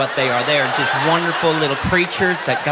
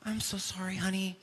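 soothing mom; 18 dB quieter than usual. Retold or imitated. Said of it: "She goes".

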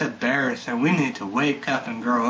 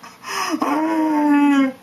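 a sudden high, loud burst of laughter; 13 semitones higher, 5 dB louder than usual. Real, not acted. Bursts out laughing mid-rant.

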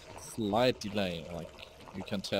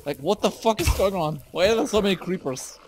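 loud, high, exasperated alarm; rattled gamer yelling; shouting; 9 semitones higher, 7 dB louder than usual. Real, not acted. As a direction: shout in disbelief at the ambush. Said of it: "I'm sorry for shouting".

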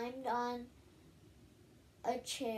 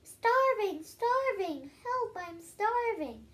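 much higher, loud dramatic wail of starving; yelling; 10 semitones higher, 6 dB louder, slower than usual. Acted. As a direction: cry out for help as if starving. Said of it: "in the grass yelling".

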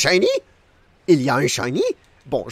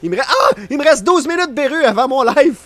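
high hurried pleading, louder; panicked urging; 8 semitones higher, 5 dB louder than usual. Real, not acted. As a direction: beg the monster to hurry up. Panicking about being late.

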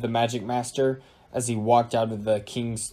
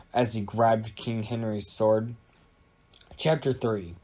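ordinary delivery, nothing marked

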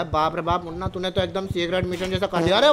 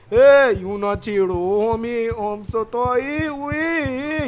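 spooky ghost voice; 2 semitones higher, 4 dB louder, much slower than usual. Acted. Teased ghost character answers back.

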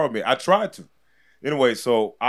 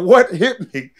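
dazed surprise; 6 semitones higher, 8 dB louder, slower than usual. Retold or imitated. Retold getting knocked across ring.

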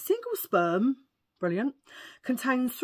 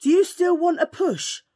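calling out loud, higher into the dark street; husband calling out loudly into the night; shout; 8 semitones higher, 10 dB louder, slower than usual. A narrator's reading. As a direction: yell up the road for the drunk man.

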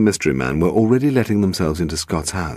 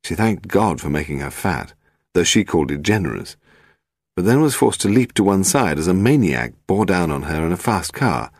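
plain reading voice

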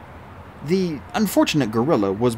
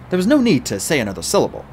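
plain reading voice

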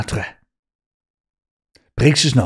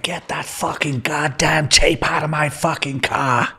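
mock furious outburst, pitched higher; retold outburst; 6 semitones higher than usual. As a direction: ham up his angry rant about the tape. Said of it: "he took a wobbler".

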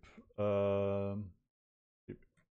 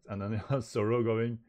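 plain reading voice

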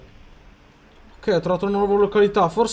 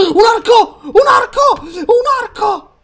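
much higher, shouting with joy; excited shout; 14 semitones higher, 9 dB louder, slower than usual. Real, not acted. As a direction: yell it out thrilled. Finds a bow, overjoyed.

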